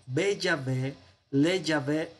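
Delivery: "Be Yahvé" and "Le Yahvé" with a clear Be and Le; Hebrew prepositions demo. Shown as a demonstration. Teacher showing students how to read the prepositions, careful demo. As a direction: spell out "Be Yahvé, Le Yahvé" slowly for the class. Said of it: "deberían leer estas preposiciones como".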